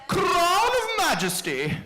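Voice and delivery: high, loud regal announcement, drawn out slowly; grand queenly voice, high and stretched; Queen Elizabeth. Acted. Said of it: "use my best Queen Elizabeth voice when announcing this one".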